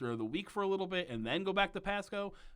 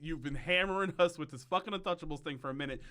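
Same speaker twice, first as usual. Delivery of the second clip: ordinary talk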